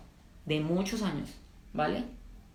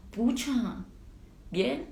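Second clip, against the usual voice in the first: relieved surprise; 4 semitones higher, much slower than usual. Retold or imitated. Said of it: "Y yo decía".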